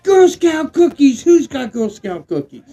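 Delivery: loud, high excited call. Real, not acted. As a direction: call it out eagerly to the whole party. Calls out, thrilled by named strain.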